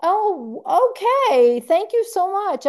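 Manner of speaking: high, pleasantly surprised gratitude; delighted thanks. Retold or imitated. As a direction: retell a gushing thank-you, pitched well up. Retold delighted thanks to the woman.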